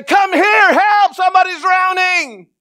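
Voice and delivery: high, loud frantic cry for help; desperate shout. Pretend. Parent screaming to the lifeguard, desperate shout. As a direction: yell for the lifeguard with everything. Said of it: "you would say".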